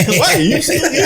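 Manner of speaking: very high squeaky cartoon voice, laughing; Quagmire impression. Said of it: "Like Quagmire".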